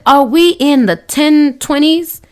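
slow, high, incredulous comeback; talking back to the neighbor, slow, high and incredulous; sarcastic retort. Retold or imitated. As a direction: pitch it up and drag it out in disbelief. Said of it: "And I said".

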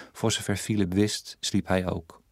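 ordinary delivery

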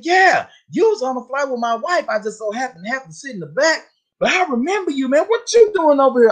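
high, eager imitation of the husband; husband's friendly chatter. Teller mimics the husband chatting her up, high.

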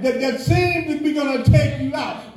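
ordinary talk, nothing marked

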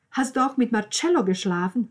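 high, loud accusing question. Acted. Sister confronted about Marcello, loudly accusing. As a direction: fire the accusation across the table.

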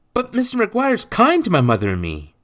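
put-on very high, loud child voice; protesting boy. A narrator's reading. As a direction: plead against it as an upset child.

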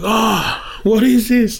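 high, startled question; amazed. Retold or imitated. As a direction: gasp out the wonder. Retold amazement at name revealed.

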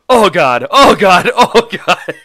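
rising panic, cracking into laughter; panicked oh God. Retold or imitated. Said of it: "Harrison just goes".

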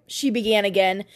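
ordinary delivery, nothing marked

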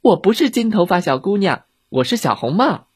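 lightly voiced child, starting high; little girl. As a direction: correct them as a proud girl.

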